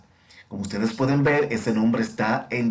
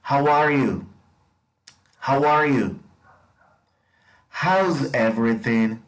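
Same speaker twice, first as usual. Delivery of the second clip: slow, clear drill model; slow teacher model for echoing; model for repetition; 3 semitones higher than usual. Shown as a demonstration. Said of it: "Repitan después de mí".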